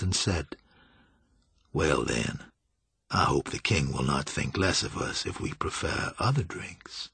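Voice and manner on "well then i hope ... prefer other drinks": low, softened voice of a sly master; sly, urbane Julian. A narrator's reading.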